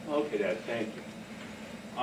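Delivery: low, soft, grudging "thank you"; grudging son. Retold or imitated. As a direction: mutter it back like a sulky kid. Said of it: "son's grudging reply".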